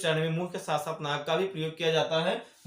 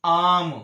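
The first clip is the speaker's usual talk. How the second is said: आम called out much louder, long आ held; emphatic example word; 10 dB louder than usual. Shown as a demonstration. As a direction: punch आम with a long आ. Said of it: "तो जैसे". (आम ज़ोर से, लंबा आ खींचकर)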